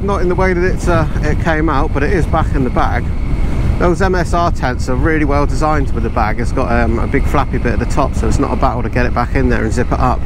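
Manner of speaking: helmet mic over engine noise; talking through a helmet while riding; inside a motorcycle helmet. Real, not acted.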